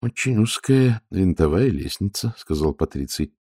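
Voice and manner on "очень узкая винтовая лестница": ordinary delivery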